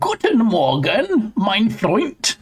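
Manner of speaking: hammed-up German accent, slow and high; bad German accent. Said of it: "That was a horrible German accent".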